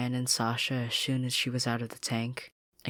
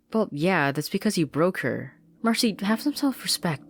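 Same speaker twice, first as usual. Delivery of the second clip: louder, higher, exasperated banter; exasperated teasing; 7 semitones higher, 6 dB louder than usual. Acted. Anne ribbing Marcy, loud and exasperated. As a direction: scold her friend half-jokingly.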